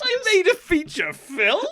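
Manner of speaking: put-on high, slow, disbelieving kid; surprised kid voice. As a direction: squeak it out in disbelief.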